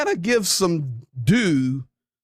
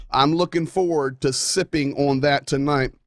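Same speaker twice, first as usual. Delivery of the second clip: ordinary delivery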